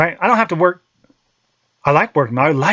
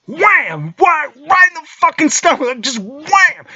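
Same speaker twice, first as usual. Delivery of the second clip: shouted impact; 10 semitones higher, slower than usual. Retold or imitated. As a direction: slam the wham like a punch. Son punches him in stomach.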